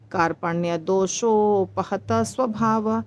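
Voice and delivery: "कार्पण्य दोषोपहत स्वभाव" said slowly, word by word; slow separated recitation. Shown as a demonstration. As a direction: recite it gently, unhurried. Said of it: "आराम से बोलेंगे".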